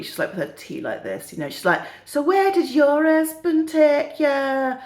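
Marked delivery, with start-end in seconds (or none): 2.1-4.8 high, slow northern English smugness; put-on northern old lady, very pleased with herself; smug northern accent. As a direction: draw it out smugly in northern English. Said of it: "the other one goes very smugly".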